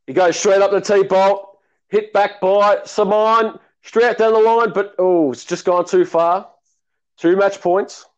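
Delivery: mock TV tennis commentary; play-by-play commentary. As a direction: call the point like a TV commentator. Live play-by-play call.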